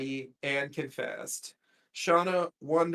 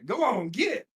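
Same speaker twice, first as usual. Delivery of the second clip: country twang, "get" jumping higher; mock country accent; 5 semitones higher than usual. Joking on Shauna sounding country, mock country accent. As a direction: shoo someone off in playful country talk. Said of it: "she doesn't usually sound country".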